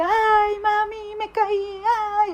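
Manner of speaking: high, whiny ouch cry to mami; crying out to mom. Retold or imitated. Preacher imitates someone hurt calling mami, high whiny cry. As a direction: wail like a hurt child. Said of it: "uno dice".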